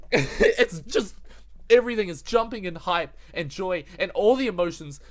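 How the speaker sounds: laughing, then shouting with joy; overjoyed. Real, not acted. Overwhelmed fan, laughing.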